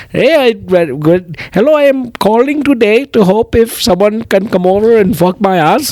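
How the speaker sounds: high, hammed-up Indian accent; mock Indian accent. Comic playing a caller with an absurd request, mock Indian accent. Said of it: "Some of them are like".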